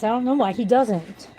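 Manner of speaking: ordinary delivery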